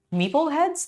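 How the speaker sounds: a high doubtful rise; puzzled echo. Real, not acted. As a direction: query the word as if it's absurd. Questioning own word.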